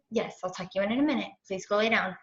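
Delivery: soft and quick, turned away from the mic. Real, not acted. Mom telling her child to lie down, hushed.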